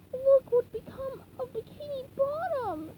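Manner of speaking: upset SpongeBob; SpongeBob crying out. Acted. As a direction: cry it out in dismay. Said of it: "he cried".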